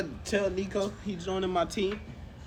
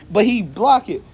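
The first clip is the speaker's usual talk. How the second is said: normal talking voice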